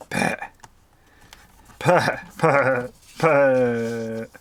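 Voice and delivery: loud, calling Cockney husband; Frank Butcher. Retold or imitated. Crafter imitating the soap husband calling for Pat, in a loud calling voice. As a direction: bellow "Pet" like a fond, despairing husband. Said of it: "your best Frank Butcher impression".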